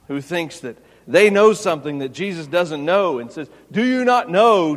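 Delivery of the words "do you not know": hammed-up haughty challenge; mock arrogant challenge. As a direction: Do you not know said much higher and louder. Preacher mocks arrogant question.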